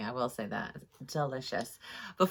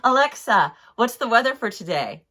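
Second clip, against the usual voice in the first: calling out to Alexa; 3 semitones higher, 7 dB louder than usual. Retold or imitated. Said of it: "She's like".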